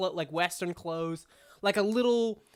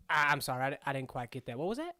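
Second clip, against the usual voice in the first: put-on hard-of-hearing old gnome; 6 semitones lower, 3 dB quieter, much faster than usual. Gnome mishears Smoke.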